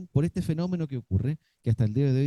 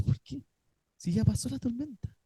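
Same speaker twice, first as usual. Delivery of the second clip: puzzled fearful question; 9 semitones higher, 4 dB quieter than usual. Retold or imitated.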